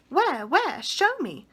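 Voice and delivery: quick excited asking, mildly breathless. Acted. Miss Lark begs Michael to show her the dog, breathless. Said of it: "said Miss Lark breathlessly".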